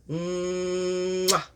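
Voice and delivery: long hummed "mmm" into a kiss; hum, then pop a big kiss; drawn-out mwah; blown kiss. Real, not acted. Blows a kiss at sign-off.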